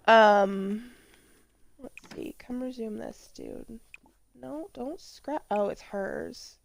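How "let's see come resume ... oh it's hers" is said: quiet mutter to herself; muttered under the breath. Real, not acted. Muttering to the game.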